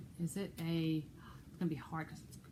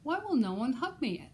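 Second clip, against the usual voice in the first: hedgehog's plea; 7 semitones higher, 6 dB louder than usual. Acted.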